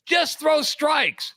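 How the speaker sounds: high, slow cliche coaching; sports dad. Retold or imitated. Dad on the sideline calling to his pitcher, mock coaching.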